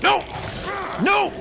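high panicked shouts; panicked yelling. Real, not acted. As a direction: cry out in sudden panic. Being attacked.

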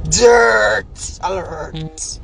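very slow, stretched-out yelled wail; long mocking howl; drawn-out jeering wail. Real, not acted. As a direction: wail it like a siren to mock him. Jeering at rival.

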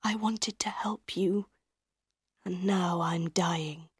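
very soft, fading voice; dying man's thoughts. A narrator's reading. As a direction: whisper it as life drains away.

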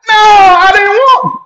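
high, loud and slow, almost a yell; loud denial. Real, not acted. Answering the viewer's question, a loud high slow denial. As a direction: shout the denial down at once.

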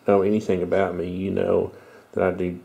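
ordinary talk, nothing marked

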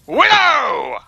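high, loud gleeful taunt; taunting battle cry. Pretend. Player charging at Widow, high taunting shout. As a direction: holler it high at your prey.

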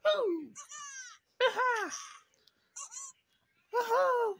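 very high cartoon whoops; Crash Bandicoot voice. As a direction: shout it like a zany cartoon hero. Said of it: "Trying to sound like Crash Bandicoot".

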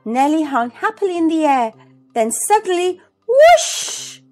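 ordinary delivery, nothing marked

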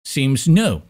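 usual speaking voice

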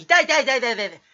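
loud, rapid yelps of ouch; cry of pain. Acted. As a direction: yelp it over and over. Crying out in pain. (痛がって大声で連呼)

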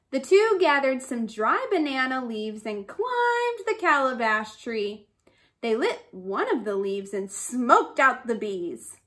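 usual speaking voice

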